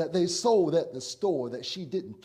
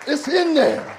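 higher, louder, punched out; preached catchphrase; 6 semitones higher, 7 dB louder than usual. Acted. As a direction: ring it out as the sermon's hook.

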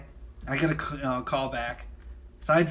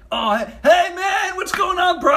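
shouting bros; 12 semitones higher, 6 dB louder than usual. Retold or imitated. Office guys greeting the newcomer, shouted mock-bro style. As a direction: holler like an over-friendly frat guy. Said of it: "they're like".

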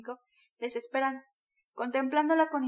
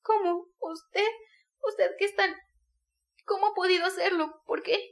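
put-on higher young woman's voice, stunned and pleading; Sonia, bewildered; 6 semitones higher, 4 dB louder than usual. A narrator's reading. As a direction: Sonia reeling from the confession. (Sonia incrédula, con voz más aguda)

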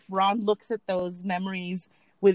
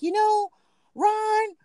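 higher, slow teasing voice; mock teasing; 9 semitones higher, 3 dB louder, slower than usual. Friend teasing husband about potatoes, high mock voice. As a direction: tease him about his pronunciation, pitched up. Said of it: "you're like".

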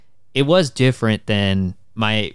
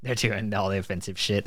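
ordinary delivery

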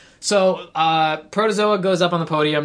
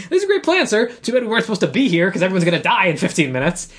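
mock sarcasm; 3 semitones higher than usual. Henchman to his boss about the plan, mock sarcasm. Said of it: "Lutz is just like".

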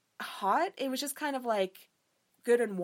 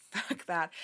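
ordinary talk

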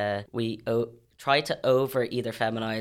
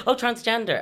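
put-on a light, higher child voice; imitating her niece; 10 semitones higher, 3 dB louder than usual. Niece answering aunt about the T, lightly voiced higher child. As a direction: play the niece remembering suddenly. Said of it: "And she's like".

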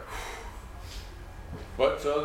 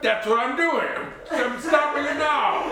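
high, raised self-defence; indignant protest; 10 semitones higher, 3 dB louder than usual. Acted. Accused father insisting he is intervening, loud and indignant. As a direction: protest loudly, pitched well up.

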